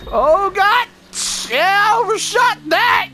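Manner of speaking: yelling in shocked delight; excited yelling. Real, not acted. Survives a huge fall, yelling.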